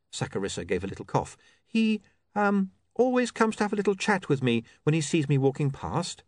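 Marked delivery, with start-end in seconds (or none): 1.6-6.2 higher, hesitant confession; coy woman reporter. Acted. Saccharissa admitting her source to William, coy. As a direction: explain it a little sheepishly.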